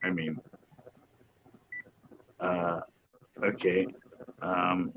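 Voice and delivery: dropped to a low murmur; quiet. Real, not acted. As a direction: keep it under your breath. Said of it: "I'm not gonna say it out loud".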